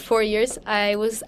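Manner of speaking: ordinary talk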